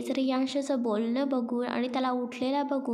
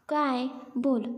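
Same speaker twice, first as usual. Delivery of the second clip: ordinary delivery, nothing marked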